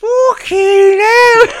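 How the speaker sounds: very high, loud and drawn out, hammed-up shock; exaggerated gasping disbelief; mock stunned cry. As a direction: wail it like a stunned old woman. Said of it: "she's going".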